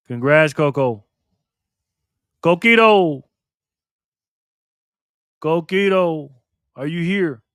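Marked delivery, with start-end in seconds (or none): 2.4-7.4 calling out. Real, not acted. Calling out a nickname.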